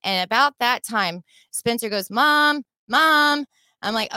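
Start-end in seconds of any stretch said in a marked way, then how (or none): 2.1-3.4 raised, loud imitation of a son calling; calling for mom. Son calls to his mom during the stop, calling out. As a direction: call out for your mother, imitated.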